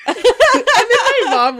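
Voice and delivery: high, loud, drawn out through laughter; cracking up while trying to tell it; laughing hard. Real, not acted. Daughter telling a friend about mom's prank reaction, laughing hard.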